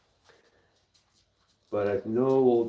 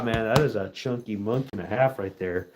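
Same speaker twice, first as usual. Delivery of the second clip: ordinary delivery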